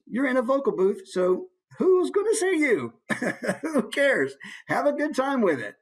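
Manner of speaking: high, hammed-up goofy voice with a laugh; playful abandon. Shown as a demonstration. Teacher urges fun in the vocal booth, playful abandon. Demonstrates letting loose in the booth.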